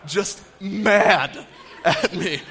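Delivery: drawn out through a laugh. Real, not acted. Laughing at the memory.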